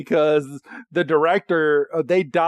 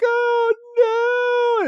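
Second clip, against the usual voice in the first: very high, drawn-out little whimper; mock tearful dismay. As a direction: imitate a baby creature about to cry. Voices Sobble about to cry.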